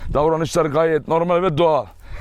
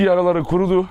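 normal talking voice

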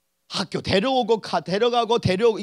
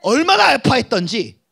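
normal talking voice

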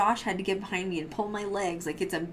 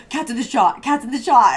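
loud, raised call to the crew; urgent repeated yell, retold; retold alarm; 5 semitones higher, 12 dB louder than usual. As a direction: laughingly re-enact hollering about an intruder. Quoting own alarm during filming.

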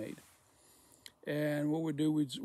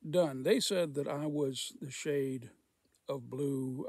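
ordinary delivery

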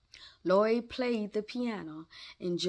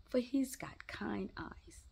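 whispered aside; 4 semitones higher, 8 dB quieter than usual. Acted.

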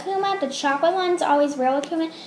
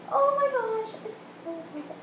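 high, hushed squeal that trails away softer; awed squeal; 10 semitones higher, 5 dB quieter than usual. Real, not acted. Vlogger feeling how soft the squishy is, awed squeal. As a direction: squeak it softly, overcome by cuteness.

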